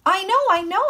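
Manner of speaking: high, loud insistent outburst; excited shouting. A narrator's reading. Son answering his father about his sister, excited shouting. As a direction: cut in loudly, brushing the reminder aside.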